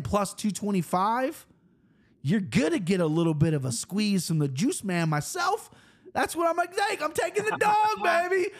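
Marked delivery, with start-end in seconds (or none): 6.1-8.5 a hint of a hammed-up Australian accent, high. Picking the underdog for the stream in a mock Australian accent. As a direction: shout it like a cheeky Aussie punter. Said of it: "that was a pretty good Australian accent right there".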